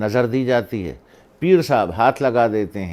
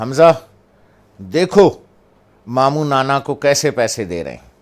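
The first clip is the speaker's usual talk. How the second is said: calling out; 3 semitones higher, 8 dB louder than usual. Retold or imitated. Mother calls grandson to watch.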